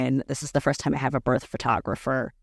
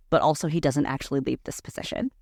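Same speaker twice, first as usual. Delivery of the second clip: ordinary talk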